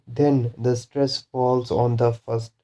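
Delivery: normal talking voice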